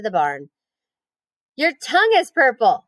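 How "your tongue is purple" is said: high teasing scream. Acted. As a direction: shout it across the barn. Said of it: "screamed Fern".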